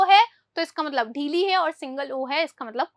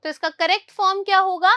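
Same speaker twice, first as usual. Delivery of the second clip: ordinary delivery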